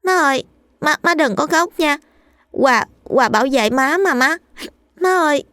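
put-on a little boy pleading with his crying mother; child's tearful voice. A narrator's reading. Little son comforting his crying mother, high tearful child voice. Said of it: "giọng trẻ con non nớt, nức nở nói".

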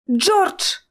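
high-pitched cry of his name; alarmed cry. A narrator's reading. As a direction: cry out to reach him. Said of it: "вскрикнула я". (тревожный вскрик высоким голосом)

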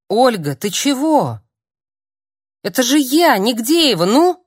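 loud, rising high plea; pleading call. Acted. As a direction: make her recognise you. Desperate plea at the door.

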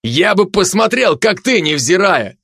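high, slow indignant retort; heated, sarcastic challenge; angry retort. A narrator's reading. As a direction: snap back in a temper, stretching it out. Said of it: "вспылил Капралов".

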